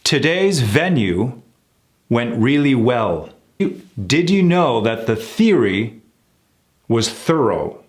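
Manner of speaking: slow, overarticulated sentence with forward L and TH; exaggerated practice sentence. Shown as a demonstration. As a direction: carve out every sound slowly. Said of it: "Let's put it all together in some sentences".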